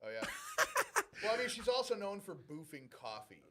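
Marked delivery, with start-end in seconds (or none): 0.3-1.2 sudden squealing laugh; high laugh burst. Real, not acted. Laughs at the story.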